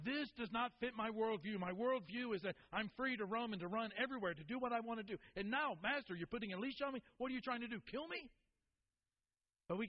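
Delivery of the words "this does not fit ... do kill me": high-pitched dog pleading its case; mock-outraged dog voice, pitched up; indignant talking dog. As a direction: argue like an affronted pet, climbing at the end. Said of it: "this dog is going".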